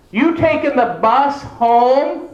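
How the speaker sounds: high, slow, every word spelled out; slow overenunciated. Pretend. Mimicking people who talk slowly to the hard of hearing. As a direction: talk like to someone who can't hear.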